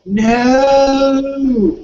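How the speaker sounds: put-on high, loud child's refusal; a kid yelling no from the toilet; whiny kid's no. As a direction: shout no like a defiant child. Child refusing, voiced by dad.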